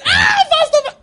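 very high, loud squeal of recognition; imitated excited shout. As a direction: shriek it like an old woman spotting someone.

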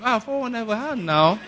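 high, teasing, with a laugh; admiring greeting. Retold or imitated. Boys greeting the fraudster, playful and high with a laugh. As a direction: greet him like a celebrity.

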